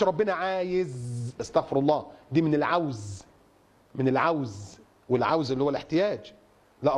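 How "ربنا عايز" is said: عايز stretched out long; slow quote, dwelling on عايز; wrong wording example. Shown as a demonstration. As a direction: draw out عايز very slowly as the word to avoid. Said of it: "فما تقولش".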